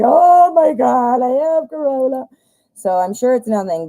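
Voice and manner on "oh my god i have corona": mock panic. Streamer mocking her own fear when she sneezes, in mock panic. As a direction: ham up the dread, stretching each word. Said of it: "I'm like".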